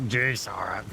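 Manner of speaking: croaky failed duck voice; Donald Duck. Acted. Trying a Donald Duck at Sora, croaky and failing. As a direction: attempt a cartoon duck in a croak. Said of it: "could maybe do a Donald Duck with this, the old croaky voice".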